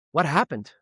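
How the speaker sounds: ordinary talk, nothing marked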